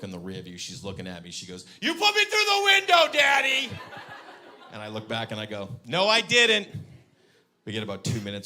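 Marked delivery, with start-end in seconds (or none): 1.8-3.7 put-on high, loud little-girl shout; mock child's yell. Daughter in back seat to dad, high outraged shout. 5.9-6.7 high, loud mock-insistent denial; louder denial. Dad looking back at daughter, loud high denial.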